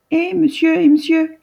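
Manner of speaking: put-on high, bright little girl's voice calling two men. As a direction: call out like a small girl from a tree house. Petite fille appelle les deux hommes. (petite fille qui appelle, voix aiguë)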